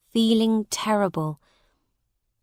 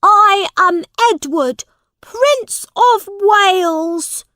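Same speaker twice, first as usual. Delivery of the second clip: very high, loud proclamation; indignant prince; 12 semitones higher, 9 dB louder than usual. A narrator's reading. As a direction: declare your rank slowly at full voice. Prince indignantly declares who he is.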